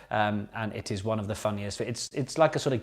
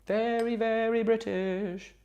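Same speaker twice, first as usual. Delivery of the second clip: mock posh; 12 semitones higher, slower than usual. Mock posh emphasis on British.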